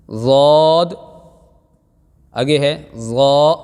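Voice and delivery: ضاد and ضا said full and heavy; careful heavy letters. Shown as a demonstration. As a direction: say ضاد and ضا thick, with care. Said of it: "اس کو بھی دھیان سے پڑھانا ہے". (موٹا کر کے ادا کریں)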